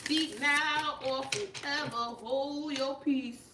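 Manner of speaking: slow, drawn-out proclamation; mock-solemn last call. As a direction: proclaim it slowly like an officiant.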